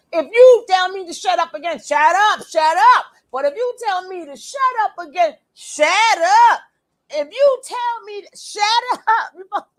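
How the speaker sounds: loud, high mock shout of shut up; mock threat. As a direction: ham up the threat.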